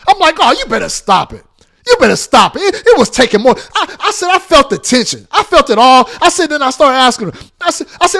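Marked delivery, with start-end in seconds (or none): 0.4-6.1 louder, higher scolding; loud warning. Retold or imitated. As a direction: raise the voice to warn her. Quarrel with wife continues.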